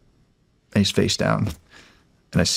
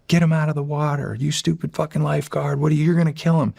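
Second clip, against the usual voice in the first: high, mock-angry crowd yelling; high, hammed-up yell at the stupid lifeguard; retold crowd screaming; 7 semitones higher than usual. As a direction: throw it like a drunk crowd abusing the lifeguard. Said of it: "people screaming".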